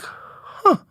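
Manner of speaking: very high, drawn-out huh, motherfucker; dad seeing the car at home; stunned dad. Retold or imitated. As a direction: gasp it in disbelief. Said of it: "the look of".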